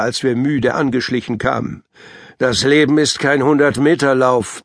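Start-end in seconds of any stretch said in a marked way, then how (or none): none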